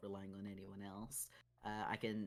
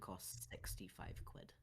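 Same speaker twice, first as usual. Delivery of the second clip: whispered; 4 semitones lower, 8 dB quieter, slower than usual. Real, not acted. Quietly grumbling about the price.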